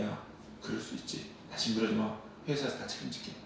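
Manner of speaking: hushed, gentle boss voice; quiet reassuring voice of a forgiving boss; soft forgiving boss. Retold or imitated. Boss forgiving employee, retold. (용서하는 사장의 조용한 목소리)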